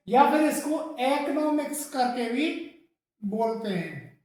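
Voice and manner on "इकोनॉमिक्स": इकोनॉमिक्स as "ek-no-miks"; इकोनॉमिक्स with an "e" at the start; alternative pronunciation. Shown as a demonstration. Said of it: "ये भी इसका सही है". (वैकल्पिक उच्चारण: इकोनॉमिक्स)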